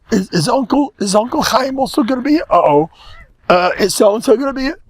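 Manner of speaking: high, fast, nervous worrying; panicked hostess. Retold or imitated. Hostess panicking over guests, high fast nervous voice. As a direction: fret about who is coming, high and quick.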